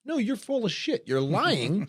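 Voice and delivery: a high, exaggerated indignant jab; hammed-up scolding of hypocrites; mock rant at people online; mock callout. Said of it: "It's like".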